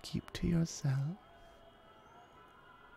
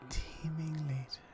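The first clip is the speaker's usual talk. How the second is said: whisper; 7 dB quieter than usual. Acted. Teasing the listener about later.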